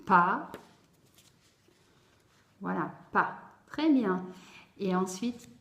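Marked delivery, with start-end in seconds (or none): 0.1-0.5 "pas" alone, loud and drawn out; "pas" projected clearly after a pause; isolated first syllable. Shown as a demonstration. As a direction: sound out "pas" as one separate syllable. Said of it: "Alors, première syllabe".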